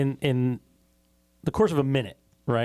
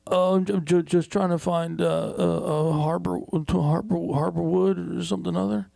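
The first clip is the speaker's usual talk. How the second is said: higher, halting mock of a confused driver; imitating a stammering stoner; 5 semitones higher than usual. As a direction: play the lost, stammering stoned guy, a bit higher. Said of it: "And he goes".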